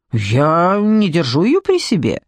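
slow, drawn-out grumble; put-out grumbling, dragged out. A narrator's reading. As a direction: grumble it in protest, stretching the words. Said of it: "проворчал Джекоби".

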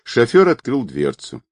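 ordinary delivery, nothing marked

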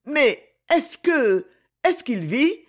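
high, anxious exclamation; high-pitched, frightened question; alarmed cry. A narrator's reading. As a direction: cry out in dread, haltingly at first. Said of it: "s'écria-t-elle en se redressant".

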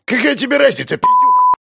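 put-on high, loud furious old geezer; raging old man. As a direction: rage at him like a mad old man. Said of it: "Лысый дед бесновался".

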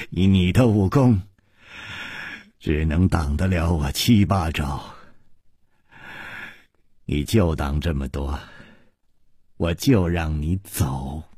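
low, measured, cold menace; cold disdain. Acted. Shuimu sets terms for Chu Liuxiang in their duel, coldly.

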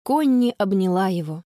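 plain reading voice

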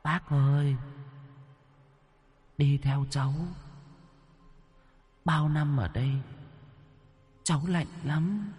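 put-on high, soft, far-off child's plea; a cold little ghost child calling; ghostly child voice. A narrator's reading. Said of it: "tiếng trẻ con quen thuộc".

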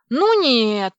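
loud, emphatic cry of refusal; defiant shout. Acted. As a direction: shout it out, breaking free. Heroine refuses, breaks free.

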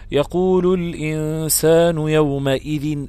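drawn-out tajweed chant; slow Quran recitation. Shown as a demonstration. Teacher quotes the verse from Surat al-Qiyama, slowly chanted. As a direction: recite slowly, holding each vowel. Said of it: "ربنا عز وجل بيقول".

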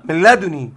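"لدني" repeated as ladunī with one n; short, light n in "لدني"; light single nun. Shown as a demonstration. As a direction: demonstrate "لدني" without doubling the nun. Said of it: "قرأوا بالنون مخففة".

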